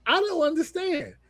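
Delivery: high, baffled mock complaint; exasperated Seth. Seth complaining to the bloodline, high and exasperated. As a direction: throw up your hands in disbelief.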